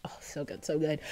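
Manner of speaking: soft unvoiced whisper. Real, not acted. Fan whispering to herself about the reference, very soft. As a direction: breathe it out to yourself.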